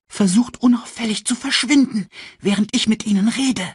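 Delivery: high, tense, urgent voice; nervous aside. A narrator's reading. Sasu to Simba and Nala before the hyenas, nervous aside. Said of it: "flüsterte Simba und Nala nervös zu".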